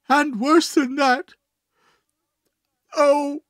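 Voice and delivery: very high, anguished wail; distraught man crying out his grief; wild sobbing. A narrator's reading. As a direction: pour out heartbreak, voice pitched right up. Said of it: "he sobbed wildly".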